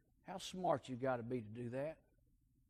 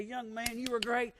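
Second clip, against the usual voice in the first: lightly voiced, higher tender old lady's blessing; loving old aunt; 8 semitones higher than usual. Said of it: "You say".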